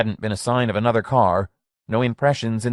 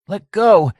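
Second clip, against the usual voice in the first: a high, loud shriek; 11 semitones higher, 7 dB louder than usual. A narrator's reading. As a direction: shriek it at him, desperate. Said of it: "shrieked Mike".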